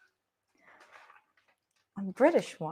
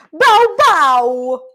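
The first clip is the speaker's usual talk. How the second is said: loud, higher bow bow bark; Italian dog bark; 6 semitones higher, 12 dB louder than usual. Pretend. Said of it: "An Italian one".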